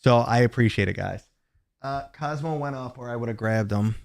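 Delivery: ordinary talk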